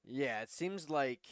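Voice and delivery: normal talking voice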